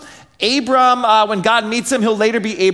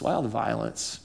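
much softer, almost under the breath; hushed repeat, lower pitch; quiet echo; 9 semitones lower, 12 dB quieter than usual. Real, not acted. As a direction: let the question sink down low. Repeated question, second softer.

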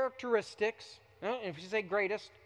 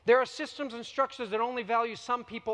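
normal talking voice

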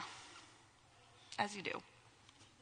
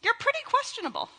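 playful teasing; 11 semitones higher, faster than usual. Pretend. Teasing the congregation.

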